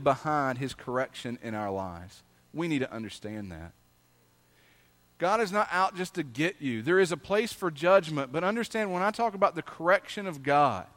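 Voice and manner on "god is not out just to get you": usual speaking voice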